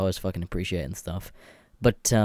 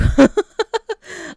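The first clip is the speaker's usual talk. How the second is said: a burst of high-pitched laughter that breaks up; can't hold it in, squeal with laughter; 9 dB louder than usual. Real, not acted. Cracking up at the box of coins, squealing laugh.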